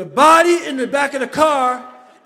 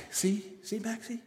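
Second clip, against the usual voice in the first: lower, much softer, whispered self-talk; muttering to himself; 4 semitones lower, 13 dB quieter than usual. Retold or imitated. Driving home, telling himself to calm down, whispered. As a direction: hush it down like you're talking yourself out of fear. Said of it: "I was like".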